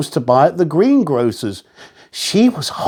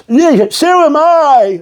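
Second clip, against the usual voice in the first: high, loud, slow agreeing; eager old man; 9 semitones higher, 5 dB louder than usual. A narrator's reading. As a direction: chime in eagerly. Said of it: "agreed Mister Whisker".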